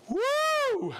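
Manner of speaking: a high, loud cheer of "Woo"; excited whoop. Real, not acted. Host whooping at a viewer's hometown. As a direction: whoop it out with real delight. Cheering hearing of the Dublin trip.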